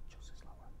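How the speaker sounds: voiceless, barely-there whisper. Shown as a demonstration. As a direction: take all the voice out and whisper. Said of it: "Should I talk like this?".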